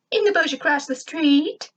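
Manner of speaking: high, chirpy little robot voice; bright squeaky helper; squeaky robot sidekick. Acted. Grr the robot sidekick answers.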